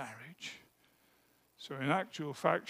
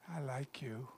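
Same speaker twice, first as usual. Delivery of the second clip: whispered, barely voiced confession; shy whisper; 4 semitones lower, 14 dB quieter than usual. Shy boy whispers to the girl he likes.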